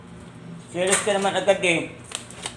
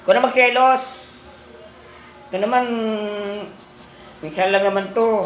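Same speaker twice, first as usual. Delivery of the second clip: high, raised-voice protest; chiding; 7 semitones higher than usual. Real, not acted. Asking a jealous pet to allow this once, chiding.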